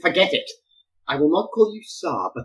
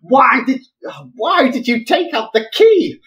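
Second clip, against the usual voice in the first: exasperated; 7 semitones higher, 5 dB louder than usual. Acted. Exasperated questioning.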